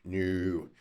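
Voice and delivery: low, quiet, dismissive answer; deadpan no. Shown as a demonstration. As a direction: drop it low and soft, dismissing the question. Deadpan answer to listed criterion.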